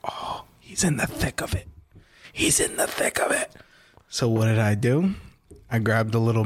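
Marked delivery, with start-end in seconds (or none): 0.1-3.5 whispered mock realization; hushed, breath-only retold thought; scheming whisper. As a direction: whisper it like juicy gossip to yourself. Gleeful realization about his friend.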